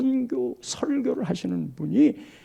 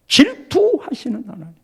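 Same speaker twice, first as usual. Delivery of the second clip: ordinary talk, nothing marked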